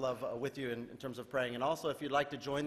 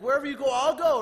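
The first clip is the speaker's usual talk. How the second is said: much higher, louder bold promise; bold Peter; 9 semitones higher, 10 dB louder than usual. Retold or imitated. Peter boasts he will follow anywhere, high loud bravado. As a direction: declare it with bravado.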